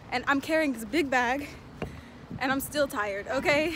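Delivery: ordinary talk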